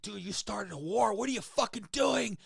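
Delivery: loud, high-pitched indignant outburst; heated confrontation. Retold or imitated. Actor replays confronting the character in the scene, loud outrage. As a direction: demand an explanation, voice shooting up. Scene partner berates him in retold scene.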